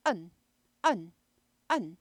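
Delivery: 은 barked from the throat; 은 as a short throaty bark. Shown as a demonstration. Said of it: "목구멍에서 짖으면서 나온 소리예요".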